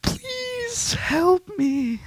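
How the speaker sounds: tiny high slow squeak of a plea; mock plea. Hyper gamer mock begging viewers in a tiny high squeak. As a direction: beg in a squeaky cartoon voice.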